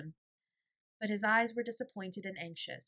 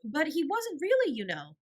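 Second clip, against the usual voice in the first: put-on higher, louder, quick child's protest; hasty child; 9 semitones higher, 8 dB louder than usual. A narrator's reading. Janet quickly correcting her sister, higher and louder. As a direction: jump in fast like a worried girl. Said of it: "put in Janet hastily".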